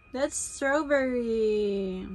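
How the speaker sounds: put-on strawberry drawn out very slowly, each syllable stretched for the baby; slow teaching voice. Shown as a demonstration.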